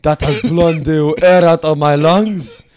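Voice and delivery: loud, hammed-up French negotiator; put-on French accent. French envoy boasting of his offer, loud French accent. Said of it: "Fuck this French accent".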